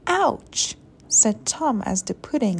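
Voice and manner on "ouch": high, quick storybook yelp; acted little cry of pain. A narrator's reading. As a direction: yelp as if bumped on landing. Tom lands hard in the grass.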